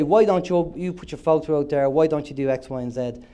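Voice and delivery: usual speaking voice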